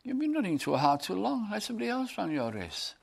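high, coaxing tempter voice; Satan whispering. Retold or imitated. Satan tempting a weary runner, coaxing. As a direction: lure him to quit, sweetly. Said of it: "Satan will whisper".

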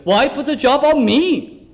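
ordinary talk, nothing marked

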